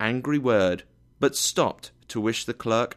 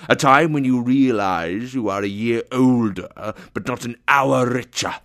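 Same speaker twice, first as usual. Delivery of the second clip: loud, grumbling tirade; angry rant; 3 semitones higher, 7 dB louder than usual. A narrator's reading. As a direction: rail loudly in a put-on miser's voice. Scrooge rants about Christmas.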